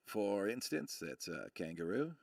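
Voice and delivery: low, soft Aussie lilt rising at the end; Aussie accent. Shown as a demonstration. Streamer shows viewers how Aussies talk, in an Aussie accent. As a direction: demonstrate an Aussie accent, quietly, sentence end lifting. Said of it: "the end of your sentence goes up".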